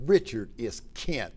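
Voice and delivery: slow, flat imitation of the wife; imitating the wife. A friend's wife answering the phone, imitated slow and cool. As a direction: drag out the name slowly. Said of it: "that's exactly how she said it".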